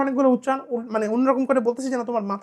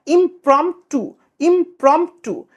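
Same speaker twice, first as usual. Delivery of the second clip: teacher dwelling slowly on impromptu for the class; slow drilled word; 4 semitones higher, 5 dB louder, much slower than usual. Shown as a demonstration. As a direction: stretch impromptu out slowly and a bit louder.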